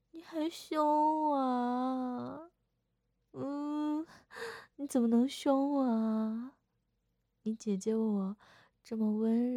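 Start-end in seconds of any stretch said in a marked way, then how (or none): 0.1-6.5 a hammed-up fake sob, higher and drawn out; mock hurt whine. Sister whining to little brother that he snapped at her. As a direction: pretend to be wounded, half crying.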